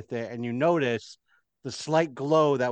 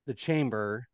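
ordinary talk

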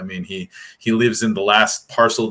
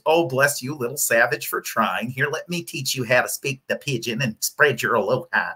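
lightly voiced patronizing outsider; a hint of a syrupy know-it-all; condescending appropriator; 3 semitones higher than usual. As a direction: talk down sweetly like a smug newcomer. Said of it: "And then it becomes".

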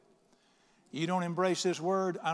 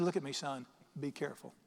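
low, quiet, grave fatherly caution; serious warning; 3 semitones lower, 6 dB quieter than usual. Retold or imitated. Father warning his newly licensed son, serious. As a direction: make him take it seriously, hushed and firm. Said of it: "I wanted him to take what I was saying serious".

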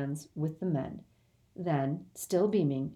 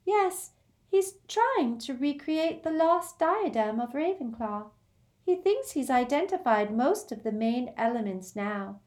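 dreamy Luna; 7 semitones higher than usual. Acted. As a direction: drift through it, serenely odd. Luna explaining her father's crown.

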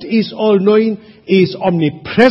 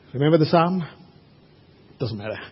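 ordinary delivery

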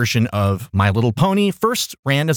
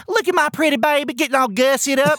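high, gushing doting mom; pageant mom voice; 10 semitones higher than usual. Retold or imitated. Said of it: "pageant mom voice continued".

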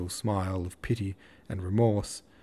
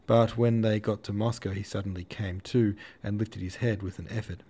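ordinary delivery, nothing marked

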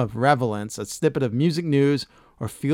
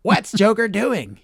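put-on higher and slow boy-sidekick voice; lightly voiced higher cartoon Robin; higher-pitched Robin; 7 semitones higher, 5 dB louder, slower than usual. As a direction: squeak it out like an eager sidekick. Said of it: "He was a little higher pitched".

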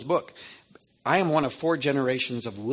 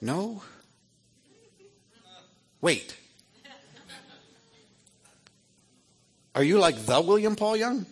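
high, disbelieving voice; astonished; 9 semitones higher than usual. Retold or imitated. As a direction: realise who's calling. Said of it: "He goes".

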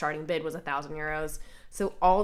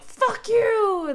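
high, loud fake outburst; hammed-up furious curse; mock angry yell; 7 dB louder than usual. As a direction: bark it like a hothead teen. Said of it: "Josuke being all like".

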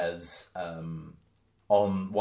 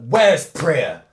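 loud, higher shout; demanding outburst; 5 semitones higher, 12 dB louder than usual. Acted. Kuldeep jumping out of his chair, loud demanding shout. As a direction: shout the question across the room. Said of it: "being like".

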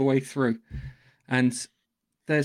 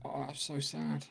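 mock sadness; 13 dB quieter, slower than usual. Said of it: "And you're like".

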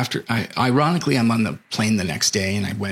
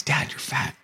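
put-on a light, higher kid's voice, a bit softer; eleven-year-old son; 4 semitones higher, 5 dB quieter than usual. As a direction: son telling his dad he's fat, lightly voiced child. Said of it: "He's, you know, eleven-year-old voice".

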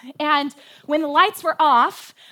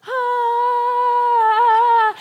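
mock falling yelp; 10 semitones higher, 6 dB louder than usual. Falling, catching self on counter.

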